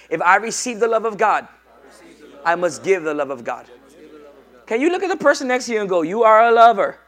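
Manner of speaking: loud chant-like lines for the crowd to repeat; call-and-response preaching. Acted. Pastor leading the congregation to repeat after him, very loud. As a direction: lead the church in a loud refrain.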